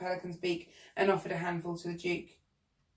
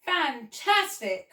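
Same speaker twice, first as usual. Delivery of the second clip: higher, drawn-out exclamation; excited cry; 5 semitones higher, 4 dB louder, much slower than usual. A narrator's reading. Pelican welcomes the window cleaning job, excited cry. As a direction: cry it out slowly with delight. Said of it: "cried the pelican".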